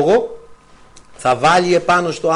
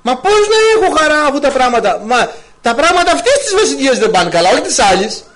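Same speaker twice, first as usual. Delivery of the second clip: high, slightly louder plaintive objection; complaining believer; 9 semitones higher, 3 dB louder than usual. Retold or imitated. Preacher voicing a discouraged listener's complaint, high plaintive protest. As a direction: protest that things are going badly.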